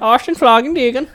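high, loud fake German gibberish; mock German. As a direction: put on a high-pitched German accent. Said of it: "That's German".

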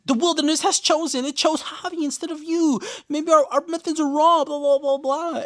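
squeaky hammed-up Misty impression; mock Misty. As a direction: parody her creepy reasoning. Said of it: "she's like".

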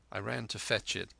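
ordinary delivery, nothing marked

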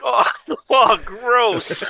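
mock disgust through laughter; gasping "gross" while cracking up; laughing. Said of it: "you're like".

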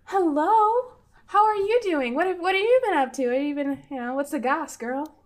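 loud, high, gushing hello to a friend; eager greeting. Acted. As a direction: burst in with chatty excitement. Kitsa greets Lily eagerly.

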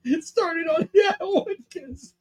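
slow, high words broken by laughter; laughing while talking. Real, not acted. Host tells which bit started it, laughing through it. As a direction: let real laughter interrupt the words. Woman laughing as she speaks.

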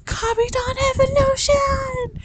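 high, loud gleeful shout; excited scream. Retold or imitated. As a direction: scream it like a fan losing it at midnight. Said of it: "I literally started screaming".

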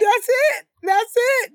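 high delighted cheering; excited cheer. Real, not acted. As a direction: cheer her on. Cheering the preacher pose.

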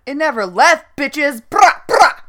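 high, loud mock hype; triumphant brag. Host replying to the hashtag, loud cocky hype. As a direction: punch it out, ending in shouted noises.

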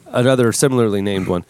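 normal talking voice

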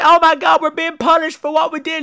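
put-on high, anxious girl's voice; frightened girl. Girl in the hole fearing punishment, high frightened voice. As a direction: fret like a scared teenager. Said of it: "And she's like".

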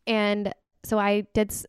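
ordinary talk

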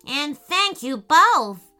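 relieved raccoon. Acted. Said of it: "said the relieved raccoon".

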